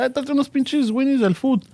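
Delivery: Spanish cousin asking for hot dogs; imitating cousins. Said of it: "That's how my cousins refer".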